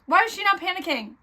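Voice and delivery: loud, high, fast disbelief; exasperated outburst. Real, not acted. Reader baffled by the book's calm heroine, exasperated outburst.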